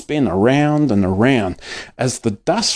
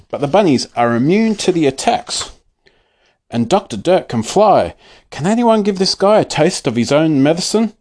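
ordinary delivery

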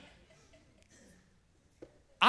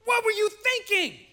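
slow, high mock-panicked shout; hammed-up shocked dad, loud and high; 14 semitones higher, 6 dB louder than usual. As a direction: act out a father freaking out at the wreck. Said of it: "he goes".

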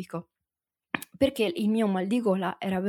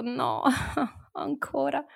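laughing dismay; 5 semitones higher, 6 dB quieter than usual. Retold or imitated. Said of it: "appena arrivata la tosse ho detto".

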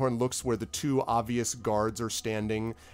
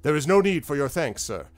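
raised, grand opening, settling down; gallant Kentabis in character; 3 semitones higher, 5 dB louder than usual. Acted. Adventurer declines the lord's thanks, grandly gallant. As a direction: wave away thanks with a hero's flourish. Game master voices Kentabis.

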